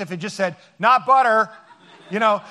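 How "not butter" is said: loud, punchy ad-slogan shout of not butter; mock slogan. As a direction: sell it like a TV ad. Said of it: "it has to be like".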